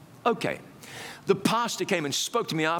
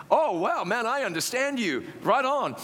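higher, delighted American reaction; excited; 6 semitones higher than usual. Retold or imitated. Young man to English pastor on phone, excited. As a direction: exclaim with relief at finally understanding. Relieved to understand the English caller.

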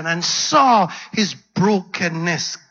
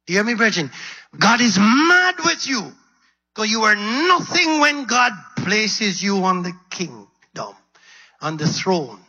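preaching fire; 4 semitones higher than usual. Real, not acted. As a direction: build to a fiery call at the church. Preacher drives the point at congregation.

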